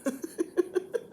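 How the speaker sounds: soft, high laugh; real quiet giggle. Real, not acted. Vlogger amused by husband's swearing on camera, laughing.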